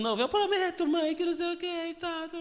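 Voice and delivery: high-pitched mock child's voice; pleading child. Son begs to leave the corner, pleading child. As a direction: promise desperately in a high kid voice. Child promises his mother.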